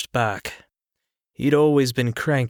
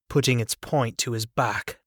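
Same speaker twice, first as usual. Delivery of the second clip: ordinary delivery, nothing marked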